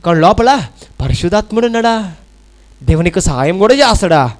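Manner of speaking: ordinary talk, nothing marked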